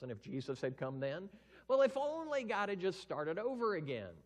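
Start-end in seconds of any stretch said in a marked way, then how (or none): 1.7-4.1 high, loud complaint; mock whiny excuse. Preacher mocking people's excuses, high loud whine. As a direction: whine it like a spoiled grumbler.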